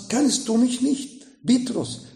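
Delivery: usual speaking voice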